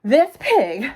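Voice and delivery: loud, high, emphatic words; indignant outburst. A narrator's reading. Eugenia complains about Mercy after the chase, indignant. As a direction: force it out indignantly. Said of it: "Eugenia was breathing very loudly".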